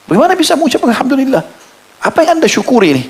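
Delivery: high, astonished disbelief; voicing a stunned stranger in a retold story; incredulous question. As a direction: ask as if you can't believe it. Man baffled by praise after loss.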